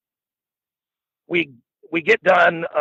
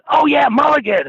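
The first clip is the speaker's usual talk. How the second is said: high, loud burst of "oh, yeah"; eager recognition; 12 semitones higher, 4 dB louder than usual. Retold or imitated. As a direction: jump in excited, sure you've got him. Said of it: "And I said".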